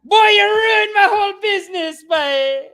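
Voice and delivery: put-on high, loud shouting West Indian accent; furious West Indian owner. Owner screaming at the crying kid, loud West Indian accent. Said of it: "just ripped him".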